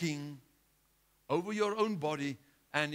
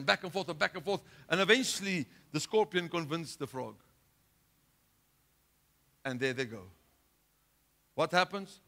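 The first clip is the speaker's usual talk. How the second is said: usual speaking voice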